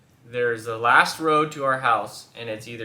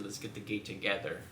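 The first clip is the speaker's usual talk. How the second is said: quiet and low, coaxing; soft, gentle suggestion; 5 semitones lower, 13 dB quieter than usual. Retold or imitated. Husband gently offering to open the gate together, soft. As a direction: say it softly to calm her. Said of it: "I got out of the car and said".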